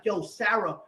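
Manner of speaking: heated retold outburst; retold raging. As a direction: replay an angry confrontation. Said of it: "I'm, I'm, I'm raging".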